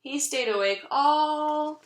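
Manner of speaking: ordinary delivery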